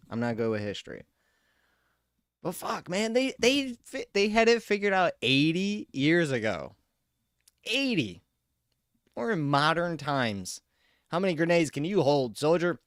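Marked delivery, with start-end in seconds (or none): 2.4-10.6 incredulous rant. Real, not acted. Ranting about game realism. 11.1-12.8 loud, barking drill sergeant voice; mock drill sergeant. Mock dialogue with game.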